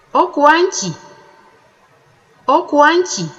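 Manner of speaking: Okwanki said high with a falling end; high tone question. Shown as a demonstration. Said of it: "The questions are posed in the high tone".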